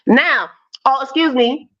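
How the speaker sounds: ordinary talk, nothing marked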